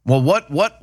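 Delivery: ordinary talk, nothing marked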